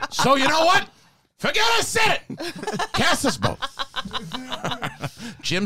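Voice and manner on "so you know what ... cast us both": high movie-star impression with laughter around it; Al Pacino. Actor gives up arguing with his director, high Pacino impression. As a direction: stretch the retraction high, then ease down. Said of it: "Pacino just went from being like that really high voice".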